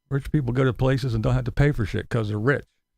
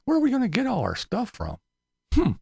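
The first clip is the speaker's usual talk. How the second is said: mock helplessness; 9 semitones higher than usual. Mocking helpless whiners.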